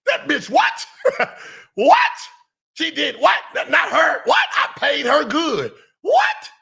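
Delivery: high, loud shocked whats; mock outrage. Host playing the guest's shocked reaction, loud and high. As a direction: ham up the disbelief.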